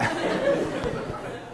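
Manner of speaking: sudden high airless laugh. Real, not acted. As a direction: burst out laughing. Student mocks poodles.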